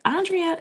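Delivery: high-pitched "Andrea" said AHN-dree-uh; mistaken name pronunciation. Shown as a demonstration. Mimicking the mistaken name, high "Andrea" as AHN-dree-uh. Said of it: "it's Andrea".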